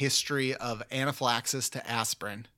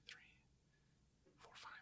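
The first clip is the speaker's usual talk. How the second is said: counting under the breath in a whisper; 35 dB quieter, much slower than usual. Real, not acted. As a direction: whisper the numbers to yourself. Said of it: "I'm counting here".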